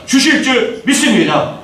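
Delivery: usual speaking voice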